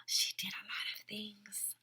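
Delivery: soft unvoiced whisper; hushed storyteller aside. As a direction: whisper it like a secret to the kids. Teacher whispers to children.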